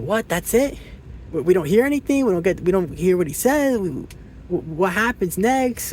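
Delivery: high incredulous voice, pitched well up; baffled Wesley. Retold or imitated. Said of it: "He's like".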